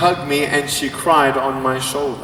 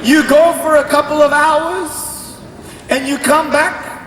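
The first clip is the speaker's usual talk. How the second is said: high, exasperated mom voice; hammed-up cross mother listing her suspicions; angry mother; 10 semitones higher than usual. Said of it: "She's so angry".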